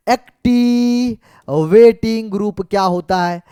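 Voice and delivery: very slow, syllables stretched out; slow dictation. Shown as a demonstration. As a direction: draw it out as if writing each syllable. Repeats it slowly while writing. (लिखते हुए खींच-खींच कर, बहुत धीरे)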